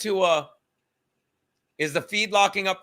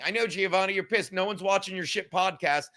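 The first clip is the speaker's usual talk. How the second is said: ordinary delivery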